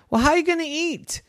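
put-on mother asking her boy, puzzled; puzzled mom.

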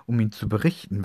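ordinary delivery, nothing marked

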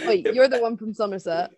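loud laugh-filled accusation; laughing shout. Real, not acted.

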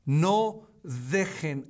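No dejen said very slowly, weight on No; slow emphasis. Shown as a demonstration. Preacher singles out the command for listeners, slow stress. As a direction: press each word of No dejen. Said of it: "No dejen que el corazón".

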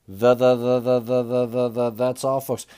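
lightly voiced stuttering pig catchphrase; a hint of Porky Pig's sign-off. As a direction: stammer through it like Porky Pig. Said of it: "a very stuttery".

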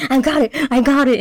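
eager cameraman. Retold or imitated. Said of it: "Fred is, like, video camera".

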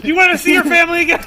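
mock villain threat.

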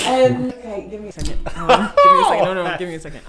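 giggling, asking for a moment; laughing stall. Real, not acted. Friend about to try an accent, giggling for time. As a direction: laugh while working up to the accent. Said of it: "You wanna hear it?".

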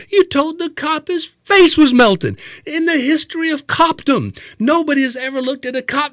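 high-pitched, incredulous mock scolding; giving him hell. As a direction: berate your idiot friend in disbelief. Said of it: "giving Greg just all kinds of hell".

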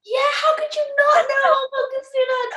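high, incredulous squeal; can't believe they didn't know; shocked disbelief. Real, not acted. Disbelief at friend not knowing.

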